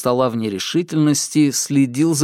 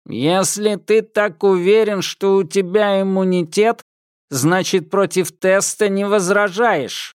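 metallic patrolman; 7 semitones higher than usual. Acted. Patrolman challenges Thomas.

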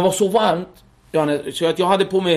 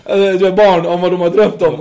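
ordinary talk

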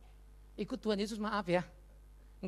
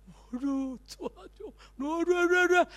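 high-pitched wailing imitation; mock crying children; 9 semitones higher, 9 dB louder, slower than usual. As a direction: imitate children bursting into hysterical tears, high and swelling loud. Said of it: "Anak-anak nangis di situ".

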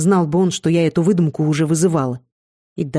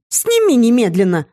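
raised, urgent command; angry demand; 5 semitones higher, 4 dB louder than usual. A narrator's reading. Seer demanding the bond be removed, raised angry command. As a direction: order it sharply with rising pitch. Seer orders him in quarrel.

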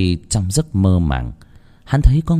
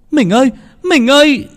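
much higher, louder female cry; woman calling out; 5 dB louder than usual. Acted. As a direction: call out to your husband desperately. Wife runs to husband calling. (tiếng vợ gọi chồng, cao và to)

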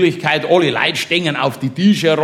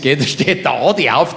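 ordinary talk, nothing marked

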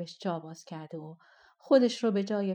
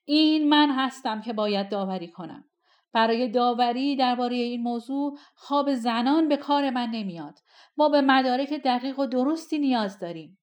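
loud, angry royal command; commanding shout; 4 semitones higher, 7 dB louder than usual. A narrator's reading. Sultan rebukes his wife before the court: loud, angry command. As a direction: bark the ruling at the court.

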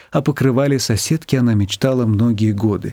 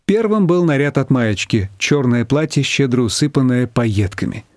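ordinary delivery, nothing marked

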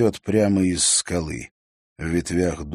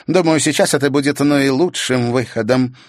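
put-on eager young witch; 7 semitones higher, 6 dB louder than usual. A narrator's reading. Witch agrees.

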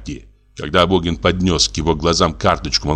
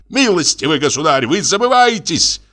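very high outraged squeal; indignant squeal; 11 semitones higher than usual. A narrator's reading. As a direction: let the pitch leap up in offence. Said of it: "взвизгнул Абогин". (возмущённо взвизгнуть)

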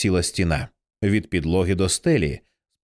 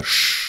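peremptory hissed "shh"; sharp shush. A narrator's reading. Dinah silencing Brian as he starts to speak, sharp hissed shush. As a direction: cut them off with a hissed shush. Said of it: "різко, безапеляційно прошипіла".

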